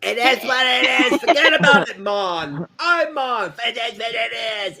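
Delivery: loud, high hammed-up New York accent; mock New York accent. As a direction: bellow a catchphrase in exaggerated New Yorkese. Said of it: "instead of the fucking New York or something".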